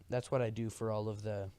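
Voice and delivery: usual speaking voice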